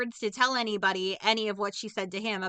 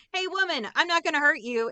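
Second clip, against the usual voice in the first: mock pleading man; 6 semitones higher, 3 dB louder than usual. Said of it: "He's like".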